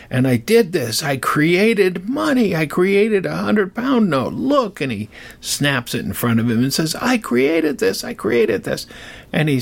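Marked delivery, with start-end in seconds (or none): 0.1-4.8 high, rising excitement; excited boasting. Acted. Apprentice showing his master the money, excited boasting. 7.0-8.9 excited boasting. Acted. Apprentice snapping the note before his master, excited boasting.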